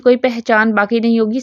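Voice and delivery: ordinary talk, nothing marked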